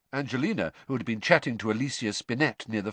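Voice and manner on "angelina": ordinary delivery, nothing marked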